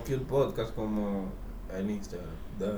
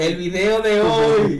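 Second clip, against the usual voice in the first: mocking echo; 9 semitones higher, 10 dB louder, much slower than usual. Mock echo of sign-off.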